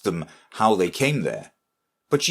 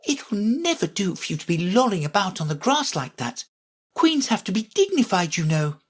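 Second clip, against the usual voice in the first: high-pitched, prim self-reproof; severe scolding; 11 semitones higher than usual. A narrator's reading. Alice scolding herself on the grass, severe. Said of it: "went on in a severe tone".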